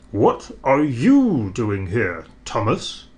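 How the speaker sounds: slow, lightly stern fat director; a mild stern grown-up questioning. A narrator's reading. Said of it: "he asked sternly".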